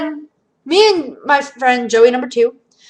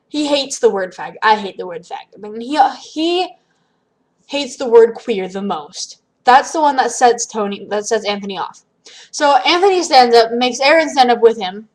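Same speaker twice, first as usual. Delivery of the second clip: normal talking voice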